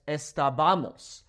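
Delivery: estábamos stressed late, es-ta-BA-mos; stress on second-to-last vowel. Shown as a demonstration. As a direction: slowly, lean on the BA in estábamos. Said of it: "natural emphasis falls right there on that a".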